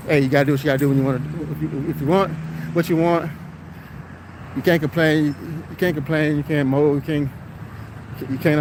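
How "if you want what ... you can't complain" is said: ordinary talk, nothing marked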